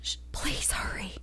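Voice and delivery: urgent whisper. Retold or imitated. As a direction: hush it, pleading. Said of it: "Why are you whispering like that?".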